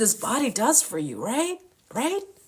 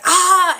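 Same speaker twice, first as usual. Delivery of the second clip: frustrated shout; 7 semitones higher, 5 dB louder than usual. Retold or imitated. Frustrated search, pulling hair.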